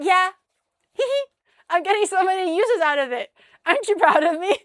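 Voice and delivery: high, loud imitation of gleeful boasting; happy and proud. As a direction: play him giggling and begging for praise. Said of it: "He was so happy about it".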